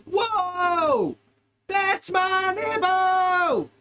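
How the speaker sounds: high, shouted cry of pain; high mock yell of shock; startled yell. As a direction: yelp it out as the bite lands. Yelling as animal bites.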